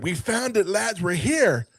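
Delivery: high, drawn-out announcement; mock triumph. Randall to the lads on arrival, imitated triumph. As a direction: lift the pitch and stretch the ending proudly.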